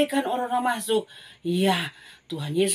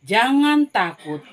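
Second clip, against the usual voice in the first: slow, louder, deliberate promise; reassuring Jesus; 4 dB louder, slower than usual. Acted. Jesus promises Jairus, slow and firm. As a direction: spell it out slowly so the children remember. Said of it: "berkata kepada Yairus".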